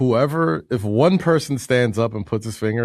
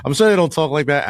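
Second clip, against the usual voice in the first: usual speaking voice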